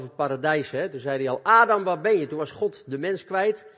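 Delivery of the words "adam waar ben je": loud, higher call into the garden; God calling Adam, loud and searching. Acted. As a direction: call out as if searching for someone lost.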